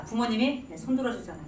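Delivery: normal talking voice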